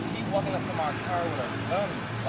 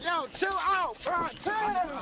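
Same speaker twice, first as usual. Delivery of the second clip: high, strained pleas to calm down; begging the cop to chill out; panicked pleading; 10 semitones higher, slower than usual. Real, not acted. Man panicking during arrest.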